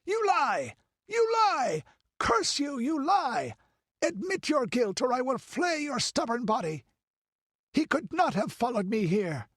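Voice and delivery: very high, crazed rage; frenzied, high-pitched fury; madman's shrieking. A narrator's reading. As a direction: shriek accusations like a man gone mad.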